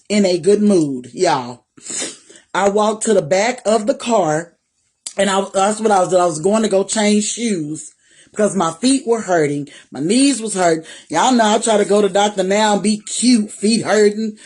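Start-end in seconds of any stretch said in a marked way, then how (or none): none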